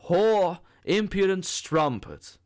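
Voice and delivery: furious shout. A narrator's reading. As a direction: hurl the insult in rage. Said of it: "he shouted".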